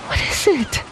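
soft, high, fearful question; mother frightened as officers hold her son, quavering softly. A narrator's reading. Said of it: "quavered Lei Chu".

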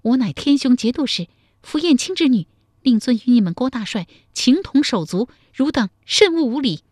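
high, loud commanding rebuke; stern shout at the rioting soldiers; scolding shout. A narrator's reading. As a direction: shout them down with noble authority. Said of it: "向乱军呵斥道".

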